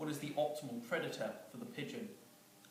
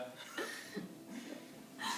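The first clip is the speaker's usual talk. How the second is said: breathy laugh; 5 dB quieter than usual. Real, not acted. Teller cracks up at own joke.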